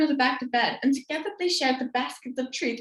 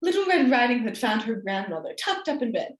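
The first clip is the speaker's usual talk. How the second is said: ordinary delivery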